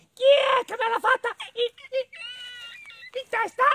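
very high, loud squeaky mole; indignant little mole. Acted. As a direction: squeak the accusation, stuttering before the last word. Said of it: "Esclamò la piccola talpa".

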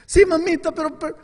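pleading. Retold or imitated. Boy begs mother to avoid punishment.

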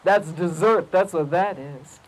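low, slowing lion voice; deep, unhurried predator musing; scheming lion. Acted. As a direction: think it aloud as a hungry lion. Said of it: "he thought to himself".

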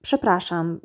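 usual speaking voice

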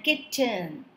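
"kitten" split as kit, ten; two separate beats on "kitten"; syllable by syllable. Shown as a demonstration. Teacher dividing the word, "kitten" in two chunks. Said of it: "dividing the word into two syllables".

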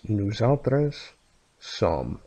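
slow, careful Catalan with the O in "autres" said as a U; teacher's Catalan. Shown as a demonstration. Teacher models the Catalan pronunciation slowly for students. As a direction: spell out "nous autres sommes" slowly with a U sound for the O. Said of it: "I'm pronouncing this O".

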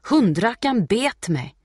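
high, loud, drawn-out complaint; pained yell. A narrator's reading. Boy bitten by Putte, yelling in pain. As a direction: cry out in outrage. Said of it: "skriker pojken".

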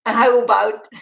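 high, loud failed Canadian "How about?"; second try at a Canadian "aboot"; self-mocking Canadian accent attempt; bad Canadian accent. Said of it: "that wasn't even close".

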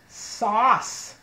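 normal talking voice

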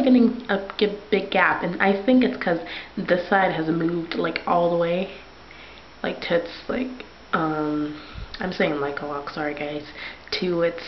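talking with lip held down; speaking around finger; finger pulling down lip. Real, not acted. Seen 1: Finger pulls lower lip down to show braces, on and off throughout.

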